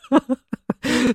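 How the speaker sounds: a sudden high burst of disbelieving laughter; high incredulous laugh. Real, not acted. Recalling the family's polite-speech rule, high laugh. Exasperated outburst at family rules.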